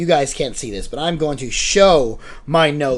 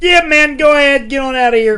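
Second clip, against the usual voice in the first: higher, loud casual shoo-off; lazy doctor; 10 semitones higher, 5 dB louder than usual. Pretend. Doctor shoos the soldier home, high casual voice. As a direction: wave him out the door.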